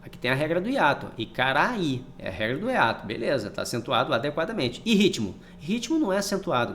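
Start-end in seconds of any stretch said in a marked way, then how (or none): none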